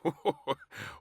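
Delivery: high hearty chuckle; a high, merry laugh. A narrator's reading. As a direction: laugh like a jolly old man. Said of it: "laughed the Prime Minister".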